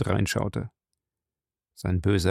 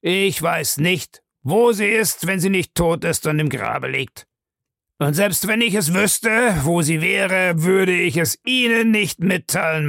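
emphatic refusal; 8 semitones higher, 4 dB louder than usual. Acted. Man refuses to tell her where mother is, emphatic. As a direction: refuse firmly, leaning on the words.

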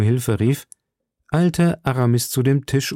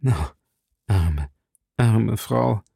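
understated sorrow, with pauses between words; broken-voiced sigh; slower than usual. A narrator's reading. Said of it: "seufzte Athos mit gebrochener Stimme".

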